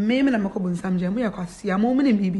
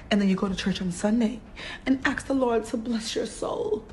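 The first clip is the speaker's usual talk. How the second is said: ordinary talk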